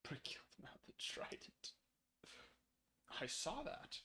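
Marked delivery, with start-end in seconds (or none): 0.0-1.7 breathy whisper, no voice. Real, not acted. Whispering an aside to the viewers. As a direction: whisper it under your breath.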